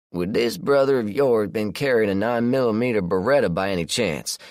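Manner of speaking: relaxed country man, unbothered; Josh, southern man. A narrator's reading. Josh voiced by narrator.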